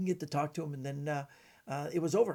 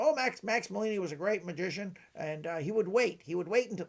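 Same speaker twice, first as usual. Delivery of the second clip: higher-pitched impression of an old magician; Vernon; 4 semitones higher, 3 dB louder than usual. Magician answering an audience question at a convention, doing an impression. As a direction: answer as the admiring magician, voice lifted. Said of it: "this is my Vernon impression".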